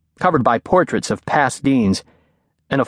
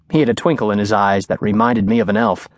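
ordinary delivery, nothing marked

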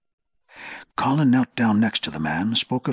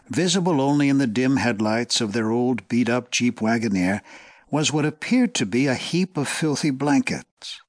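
ordinary delivery, nothing marked

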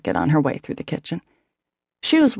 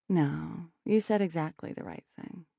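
fading quiet; 13 dB quieter than usual. A narrator's reading. Leaning on brother, grieving.

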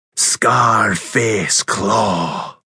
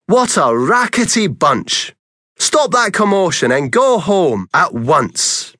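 annoyed neighbours; 6 semitones higher than usual. Acted. Neighbours shouting at noisy cats.

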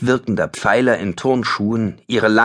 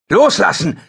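much higher, loud commanding bellow; 10 semitones higher, 5 dB louder than usual. Acted. Ben bellows at the attacker to let the girl go. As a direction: roar the order to let go. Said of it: "brüllte er".

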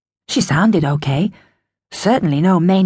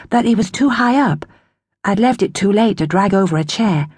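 ordinary delivery, nothing marked

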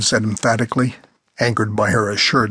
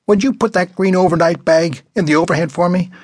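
put-on a pushy old lady, pitched up; bossy old woman; 6 semitones higher, 3 dB louder than usual. A narrator's reading. Old woman ordering a young man out of his seat, pitched up. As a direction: demand it like a rude old woman.

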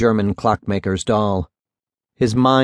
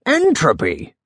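mild, higher-pitched squeal; 5 semitones higher than usual. A narrator's reading. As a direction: squeal it lightly, as the old judge. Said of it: "the judge squealed".

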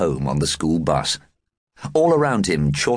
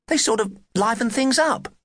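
put-on high girl voice. A narrator's reading. Friend continues, voiced.

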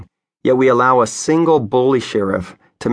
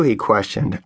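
usual speaking voice

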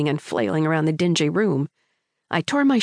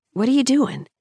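lifted curious question; 7 semitones higher than usual. A narrator's reading. Boy curiously asks what the girl is doing. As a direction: ask it with puzzled interest. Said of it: "he asked curiously".

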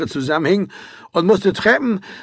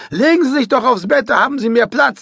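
pitched up, offhand invitation; lightly voiced cabin man; 8 semitones higher than usual. A narrator's reading. Stoker offers Karl the bed, mildly voiced higher. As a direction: wave him onto the bed, lightly.

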